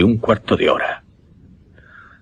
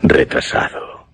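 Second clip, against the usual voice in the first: puzzled; 6 semitones lower, slower than usual. Acted. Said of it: "Me extrañó su comentario".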